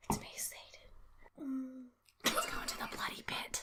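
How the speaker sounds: spooky, very soft whisper; hushed, hammed-up devil whisper; whispered mock Satan. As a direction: whisper like a fake ghostly recording. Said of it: "it's the Satan panic, so they're just like".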